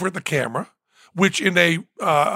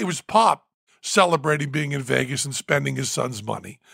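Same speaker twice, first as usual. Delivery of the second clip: ordinary delivery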